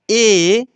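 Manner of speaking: teacher reciting ए; letter recited clearly. Shown as a demonstration. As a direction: spell out ए as a distinct recited letter. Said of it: "जैसे उच्चारण कराया हूँ".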